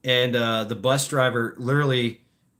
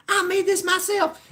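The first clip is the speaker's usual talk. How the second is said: imitating the lady in a much higher voice; proud lady. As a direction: put on her proud, high little voice.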